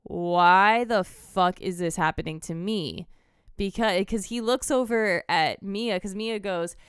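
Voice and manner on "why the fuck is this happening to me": slow, drawn-out disbelief; retold disbelief. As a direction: spell out the dismay word by word. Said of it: "It's more or less just like".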